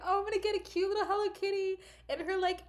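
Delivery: put-on high, fast gushing girlish excitement; excited. Vlogger quoting her past excited self, high gush. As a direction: bubble over imagining the cute plushie. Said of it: "I was so excited".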